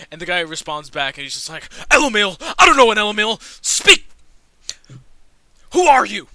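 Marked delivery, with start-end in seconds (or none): 1.9-6.3 very loud, high-pitched demand; overpowering gatekeeper roar; booming voice. Acted. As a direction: bellow suspiciously at the visitor. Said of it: "booming voice".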